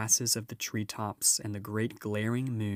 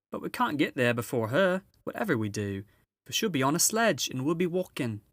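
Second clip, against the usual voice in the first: higher, bustling motherly beaver voice; a brisk, busy housewife giving orders; Mrs Beaver; 6 semitones higher, 5 dB louder than usual. Acted. Mrs Beaver ordering her husband to fetch supplies, bustling and higher.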